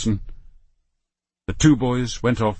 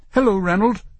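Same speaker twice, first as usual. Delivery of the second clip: put-on high, cheerful child's hello; bright greeting; 12 semitones higher, 3 dB louder than usual. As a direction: greet a friend gladly. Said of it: "he said brightly".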